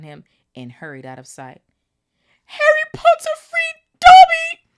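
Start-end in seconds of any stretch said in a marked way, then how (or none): 2.4-4.7 high, loud elf cry of joy; shrill elf. Acted. Dobby crying out to Harry in shrill joy. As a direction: squeal it out in delight. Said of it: "said the elf shrilly".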